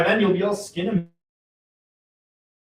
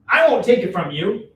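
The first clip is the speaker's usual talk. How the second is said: ordinary talk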